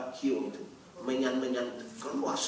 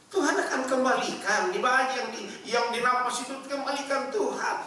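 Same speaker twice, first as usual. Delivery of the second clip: high, loud, forceful exhortation; fiery preaching; 13 semitones higher, 7 dB louder than usual. Acted. Preacher exhorts the congregation, loud and forceful. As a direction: thunder it at the congregation.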